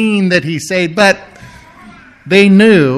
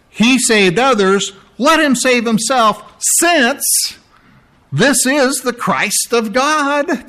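slow, higher mocking sneer; 5 semitones higher, slower than usual. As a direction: drip scorn on every word. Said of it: "in a mocking way".

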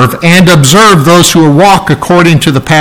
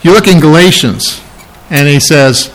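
ordinary talk

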